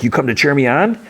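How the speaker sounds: high, loud character voice; Sweet Tooth. Retold or imitated. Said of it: "Sweet Tooth comes up to him".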